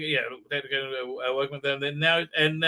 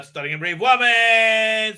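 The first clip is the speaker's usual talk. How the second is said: high, loud, stretched-out slogan; sarcastic fanfare, dragging the last word; mock celebration; 6 semitones higher, 7 dB louder than usual. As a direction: overblown mock-triumphant cheer.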